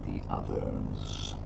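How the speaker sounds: deep, slow ancient dragon voice; low slow rumbling elder; old man voice. Acted. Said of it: "Old man voice, what?".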